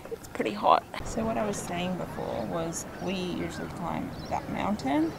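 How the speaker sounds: ordinary talk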